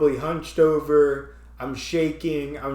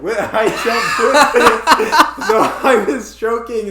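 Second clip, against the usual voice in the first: laughing; 6 semitones higher, 11 dB louder than usual. Real, not acted. Laughing through the retelling.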